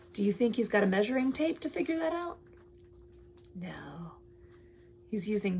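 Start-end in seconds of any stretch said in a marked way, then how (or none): none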